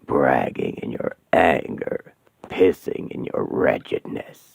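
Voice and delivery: very low, slow, hollow demonic voice. Acted. Demon Asmodeus greeting the trapped women, low and menacing. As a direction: loom over them like a demon.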